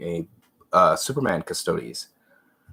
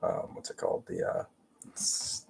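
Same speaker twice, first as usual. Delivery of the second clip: whispered mutter; 12 semitones lower, 10 dB quieter than usual. Real, not acted. Muttering to self while painting.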